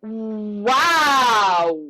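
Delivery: "Wow" as a long swelling vocal exercise; small to big. Shown as a demonstration. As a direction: let "Wow" bloom slowly and fall away. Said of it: "So it's small to big".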